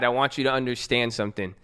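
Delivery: usual speaking voice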